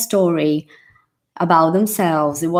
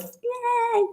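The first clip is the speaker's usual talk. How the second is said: excited yay; 7 dB quieter than usual. Real, not acted. Cheering a course student joining.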